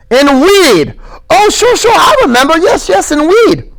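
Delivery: very high, eager stammer; smiling excited high voice; delighted Lenny. Acted. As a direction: burst out happily like a child.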